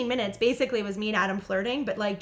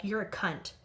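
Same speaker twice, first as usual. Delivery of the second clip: quiet, low deadpan delivery; deadpan child; 3 semitones lower, 8 dB quieter, slower than usual. Retold or imitated. Son coolly insulting Olivia, deadpan and quiet. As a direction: say it softly with a straight face. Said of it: "dead in the face".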